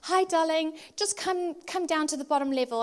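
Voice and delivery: high, loud sing-out to a darling; imitated sweet husband. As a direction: play the patient husband, exaggeratedly nice. Husband's reassuring call, acted.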